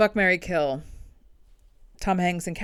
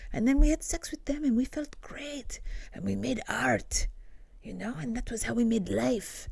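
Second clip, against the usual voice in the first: whispered bad French accent; creepy whisper in a bad French accent; 5 semitones higher, 6 dB quieter than usual. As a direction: breathe it in a mock French accent. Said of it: "not even a good French accent".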